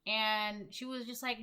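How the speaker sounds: ordinary talk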